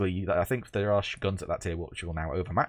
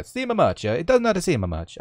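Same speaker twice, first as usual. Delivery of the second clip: higher, hammed-up Italian accent; mock Italian accent; 8 semitones higher, 5 dB louder than usual. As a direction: slip into a cartoon Italian accent. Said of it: "why I had to go to Italian".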